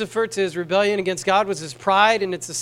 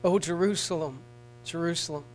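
softer, mournful sigh of a lament; 2 semitones lower, 5 dB quieter than usual. Acted. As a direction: let it fall away quietly, grieving. Lament over Jerusalem.

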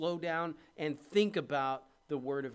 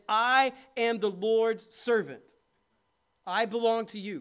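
high, loud, slow proclamation; fervent surrender; 6 semitones higher, 10 dB louder, slower than usual. Acted. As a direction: proclaim each word slowly and loud. Preacher voicing Mary, full force.

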